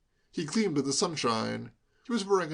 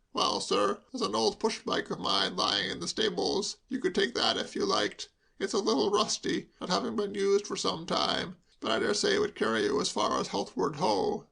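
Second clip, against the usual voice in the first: lightly voiced, helpful chauffeur, a little higher; a hint of an obliging chauffeur; kindly chauffeur; 6 semitones higher than usual. A narrator's reading. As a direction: offer the old bike kindly. Kindly chauffeur offers his bike.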